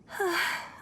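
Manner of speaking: a long dreamy sigh as she thinks of him; wistful sigh. Acted. Fiancée dreaming of her lost fiancé, lovesick sigh.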